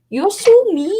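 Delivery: put-on high-pitched little Irish child; wee Irish accent. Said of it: "the wee Irish accent".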